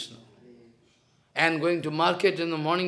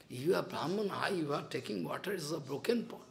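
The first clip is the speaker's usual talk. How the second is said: much softer, lower pleading; hushed plea; 5 semitones lower, 14 dB quieter than usual. Retold or imitated. Sridhar quietly begs Mahaprabhu not to drink, hushed plea. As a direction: murmur it anxiously, almost to yourself.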